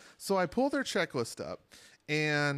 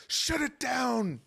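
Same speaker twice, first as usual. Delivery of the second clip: bad English accent, slow and high; 9 semitones higher, much slower than usual. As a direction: Gordon Ramsay style shouted order. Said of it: "was that your English accent?".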